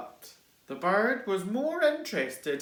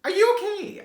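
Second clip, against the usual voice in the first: worried snails; 6 dB louder than usual. Acted. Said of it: "asked the snails".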